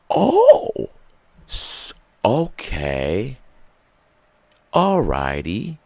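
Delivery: high, drawn-out mock astonishment; hammed-up disbelief; mock shocked. Sarcastic shock in story.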